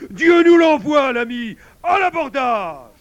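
very high, full-throated shout; battle cry. A narrator's reading. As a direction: sound the charge with savage glee. Wolf launches attack on priest. (cri d'abordage triomphant)

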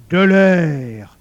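loud, long growled exclamation; growling wolf. A narrator's reading. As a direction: boom it out like a hungry beast. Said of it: "gronda le loup". (grondement de loup, fort et traînant)

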